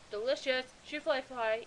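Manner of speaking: ordinary talk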